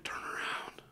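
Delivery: eerie voiceless whisper; mysterious whisper. Retold or imitated. Unseen voice to the walking man, mysterious whisper. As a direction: whisper it like a voice from nowhere.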